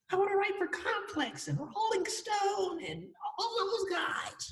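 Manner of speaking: mock his own naive younger voice; high younger self. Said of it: "my voice was probably that high back then".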